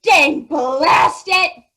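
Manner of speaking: very slow, furious bellowed curse; angry roar. Acted. Lion in a fury, roaring a curse. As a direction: roar it out in a rage. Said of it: "heard an angry animal roaring".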